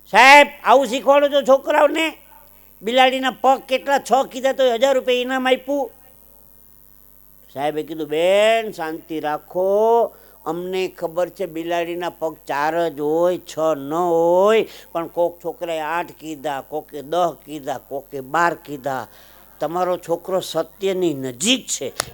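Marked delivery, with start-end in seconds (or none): 0.1-5.9 high, loud indignant complaint; furious parent act, pitched well up; angry mother. Acted. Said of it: "બેનનો મગજ ગયો". 8.1-22.1 slow, drawn-out patient explanation; unhurried, soothing schoolmaster act; placating teacher. Acted. Teacher placates the angry mother.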